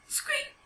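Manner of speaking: a tiny, very high mouse squeak. A narrator's reading. Mouse wakes with a start.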